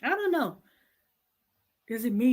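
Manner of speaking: ordinary talk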